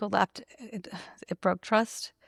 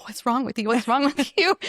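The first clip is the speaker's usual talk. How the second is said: laughing concern; 5 semitones higher, 5 dB louder than usual. Retold or imitated. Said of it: "they're just like".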